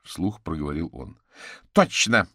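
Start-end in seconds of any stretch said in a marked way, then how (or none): 1.7-2.3 loud, higher-pitched exclamation; exclaiming. A narrator's reading. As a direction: burst out in agreement. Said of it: "воскликнула вышедшая из кухни Маргарита". (громкое восклицание)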